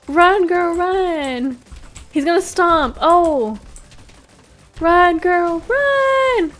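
high, loud frantic yells; being chased. Real, not acted. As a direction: scream at her to run.

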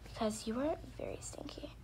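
usual speaking voice

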